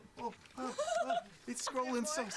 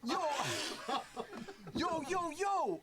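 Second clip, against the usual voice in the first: put-on New York accent; 3 semitones higher, slower than usual. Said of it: "are they, like, New York or Jersey".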